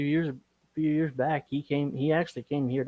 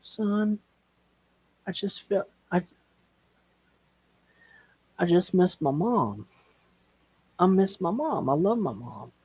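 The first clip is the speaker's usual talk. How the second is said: higher, halting voice of a crying dad; tearful retelling; 7 semitones higher than usual. Retold or imitated. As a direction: imitate a grieving father, breaking off between phrases. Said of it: "never heard my dad cry before".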